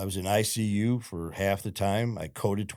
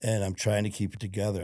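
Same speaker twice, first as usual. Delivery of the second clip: ordinary delivery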